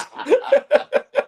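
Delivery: loud high-pitched laugh; high burst of laughter. Real, not acted. Teller laughing at favorite movie line, high and loud. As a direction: cackle at your own joke.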